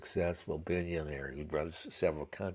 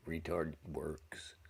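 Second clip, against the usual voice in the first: normal talking voice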